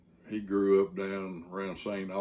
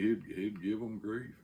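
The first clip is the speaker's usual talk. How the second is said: ordinary talk, nothing marked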